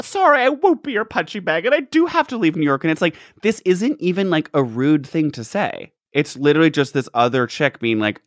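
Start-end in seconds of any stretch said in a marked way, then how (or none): 0.0-2.8 high, huffy mock-martyr voice; a hammed-up huffy exit line. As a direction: play her as wounded and dramatic. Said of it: "And she's like".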